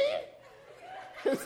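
high, voiceless burst of laughter; laughing. Real, not acted. Speaker cracking up at his own classroom story, laughing.

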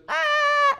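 very high, loud mock toddler wail; piercing pretend baby howl. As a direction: let out a hammed-up crying shriek like a two-year-old. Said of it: "and starts crying".

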